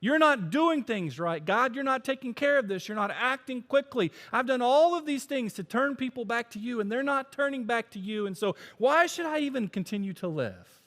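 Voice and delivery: loud, high, whiny self-pity; whining pity party. Acted. Preacher voicing Elijah sulking at God, loud whiny pity party. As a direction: complain to God like a sulking child. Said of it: "He's having a pity party".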